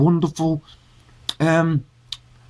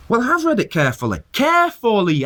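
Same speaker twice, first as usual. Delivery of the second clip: indignant; 6 semitones higher than usual. Real, not acted. Indignant retort to the leaflet.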